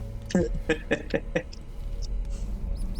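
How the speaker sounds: unvoiced giggle; breathy giggle. Real, not acted.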